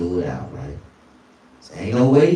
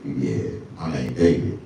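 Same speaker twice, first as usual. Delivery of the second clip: a lower, smooth self; low cool reply; 11 semitones lower, 6 dB quieter than usual. Retold or imitated. His own cool answer.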